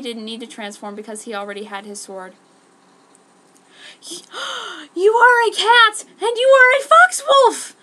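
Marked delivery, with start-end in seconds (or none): 3.8-4.9 gasp. Acted. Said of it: "Mint gasped". 4.9-7.8 very high, loud astonished cry; surprised. Acted.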